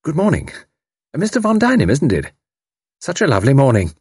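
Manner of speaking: high, chirpy chatter; twittering. A narrator's reading. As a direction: chirp it brightly like a fussy lady. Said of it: "Tuppence twittered at him".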